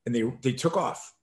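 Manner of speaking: ordinary delivery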